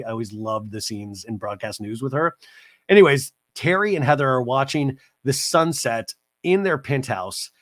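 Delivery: ordinary talk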